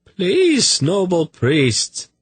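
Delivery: very high, drawn-out plea; anguished high-pitched begging. A narrator's reading. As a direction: beg desperately, voice leaping up. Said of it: "voice was anguished now".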